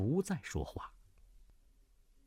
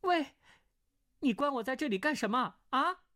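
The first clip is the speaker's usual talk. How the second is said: put-on higher young woman's voice, demanding; a trapped girl calling through the wall; 14 semitones higher than usual. (高声质问的少女)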